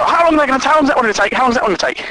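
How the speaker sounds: high, pestering repeated question; mock someone who won't stop asking; impatient nagging. Pushy colleague asking the bug fixer for timings, impatient.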